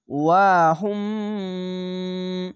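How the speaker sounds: ওয়া হুম slowly, the ম held long and hummed; Quranic recitation. Shown as a demonstration. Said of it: "ঈদগামে মিম সাকিন গুণ না". (ধীরে টেনে তিলাওয়াত)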